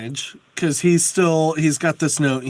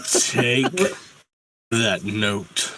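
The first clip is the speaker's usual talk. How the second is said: dragon voice; 6 semitones lower, much slower than usual. Acted. Dragon speaks.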